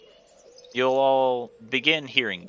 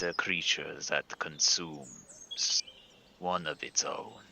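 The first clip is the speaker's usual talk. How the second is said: hushed ominous whisper; 6 semitones lower, 9 dB quieter than usual. Acted. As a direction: whisper it like a dark secret. GM hushed lore telling.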